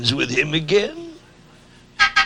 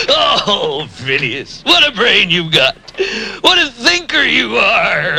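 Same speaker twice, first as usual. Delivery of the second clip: ordinary talk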